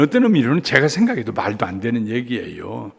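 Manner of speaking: normal talking voice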